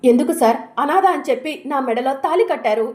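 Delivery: loud, hurt demand for an answer; angry accusation. Acted. Wife confronting her husband, loud and bitter. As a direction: throw the question at him.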